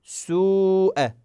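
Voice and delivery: سوء with the oo drawn out very long before the hamza; slow recitation stretch; long madd on waw. Shown as a demonstration. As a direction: hold the uu in سوء. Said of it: "في حرف الواو الساكنة المدية نقول سوء".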